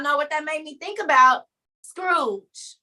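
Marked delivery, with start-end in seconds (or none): none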